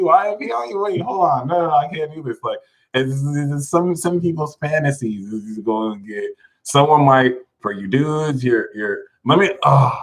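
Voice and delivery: ordinary talk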